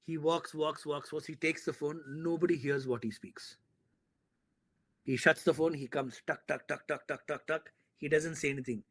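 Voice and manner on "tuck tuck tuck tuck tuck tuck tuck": rhythmic tuck-tuck footstep noises; evenly spaced vocal footfalls; imitated footsteps. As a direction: tick out steady, even footsteps with the voice. Imitates footsteps of Sonny walking back.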